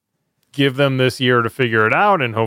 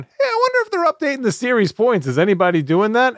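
high-pitched voicing of an idle thought; mock wondering; 13 semitones higher than usual. Host airing a passing thought about series points, mock wondering. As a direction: act out idly wondering, voice pitched way up.

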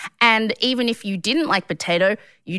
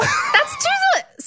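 very high squeal of disbelief; incredulous laughing protest. Real, not acted. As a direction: squeal it, cracking up.

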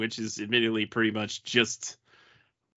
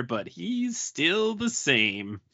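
slow emphatic; 4 semitones higher, 4 dB quieter, much slower than usual. Real, not acted.

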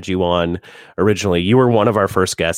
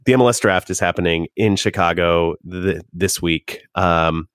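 ordinary talk, nothing marked

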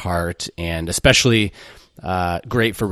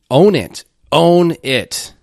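much louder and higher, punchy command; rallying command; 8 semitones higher, 7 dB louder, slower than usual. Real, not acted. Host urging his listeners to take ownership, loud rallying command. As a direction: drive it home like a coach.

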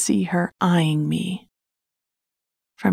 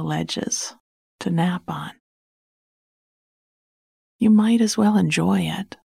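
placating; 3 semitones higher than usual. Acted. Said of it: "she said in a placating voice".

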